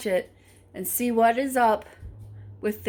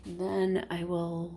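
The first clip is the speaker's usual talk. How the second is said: ordinary delivery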